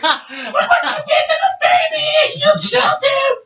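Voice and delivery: high-pitched, panicked mock shriek; accusing shriek. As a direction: screech the accusation like a horrified sister. Sister yelling in retold bath panic.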